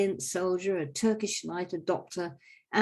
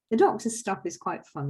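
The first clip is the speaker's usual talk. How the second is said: ordinary delivery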